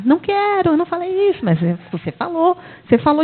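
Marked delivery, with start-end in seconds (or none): 0.0-1.4 mock objector. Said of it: "Ele fica lá".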